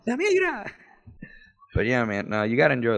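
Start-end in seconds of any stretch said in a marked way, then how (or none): 0.1-0.7 very high holler through laughter; mock alarm cry. Joker repeating the gag warning to the room, high mock alarm. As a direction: sing out a fake warning, pitched way up.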